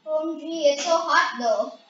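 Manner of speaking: loud excited exclaim. Real, not acted. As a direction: blurt it out loud. Lava heat, loud exclamation.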